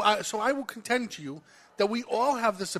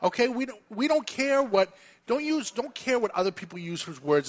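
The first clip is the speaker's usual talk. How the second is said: ordinary talk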